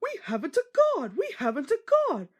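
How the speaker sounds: worried coach calling to the engine; alarmed. A narrator's reading. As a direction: cry out the warning urgently. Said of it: "Annie tried to tell Thomas".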